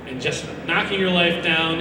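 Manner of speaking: ordinary delivery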